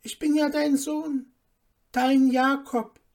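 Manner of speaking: high, earnest appeal; pleading. A narrator's reading. Son begging his mother to know him, pleading. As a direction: plead to be recognised.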